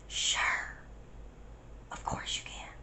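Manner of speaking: soft, hushed reply; retold whisper. Lending friend her phone while hiding, retold whisper. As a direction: whisper your agreement. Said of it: "it was like S M R the whole time".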